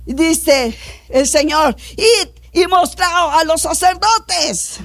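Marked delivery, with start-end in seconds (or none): 2.0-4.8 slow, commanding proclamation; solemn ringing order; proclaimed order. Acted. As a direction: give it as a drawn-out command. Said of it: "solo le dio una orden".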